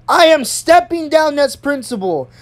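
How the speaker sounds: high-pitched, emphatic declaration. Retold or imitated. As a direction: imitate an angry principal announcing departure. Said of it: "She literally raised her voice".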